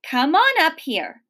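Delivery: mildly stern summons; hard and serious. A narrator's reading. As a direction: order the child up firmly. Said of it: "her voice all hard and serious".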